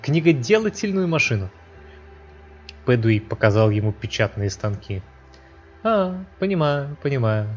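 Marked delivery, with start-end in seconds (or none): 0.0-1.5 put-on high-pitched arrogant young prince; haughty high voice. A narrator's reading. The prince speaks. 5.8-7.0 high, slow condescending drawl; slow haughty drawl. A narrator's reading. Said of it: "высокомерно заявил".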